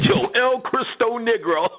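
mocking the guy who showed up at the door; imitating a visitor.

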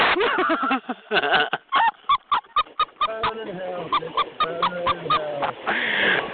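long, high shrieking cackle. Real, not acted. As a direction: cackle in delight at your own burn. Cackles after telling viewer off.

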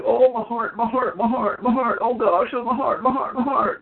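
high-pitched, hammed-up heart complaint; mock ailing old man. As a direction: wail feebly like a man faking heart trouble. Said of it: "He was like".

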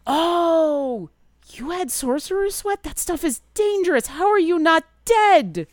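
high and loud shocked gasp of disbelief; the bird bard hearing about the sorcerer's sweat, loudly shocked; surprised bird bard. Acted.

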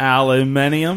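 "Aluminium" drawn out the Australian way, rising; foreign pronunciation. Acted. Topping off the steakhouse bit, "Aluminium" in an Aussie pronunciation. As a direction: stretch "Aluminium" slowly into five syllables. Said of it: "we say things like aluminium".

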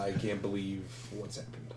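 soft, slow, stunned; shaken murmur. Acted. Arlo shaken after the squid attack, soft slow murmur. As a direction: barely get the words out. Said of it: "And Arlo is like".

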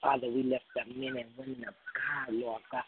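normal talking voice

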